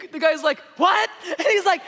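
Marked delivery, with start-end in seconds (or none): 0.8-1.1 high, loud questioning yell; screaming match. Acted. Stranger yelling back at Norm, screaming. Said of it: "they begin a screaming match".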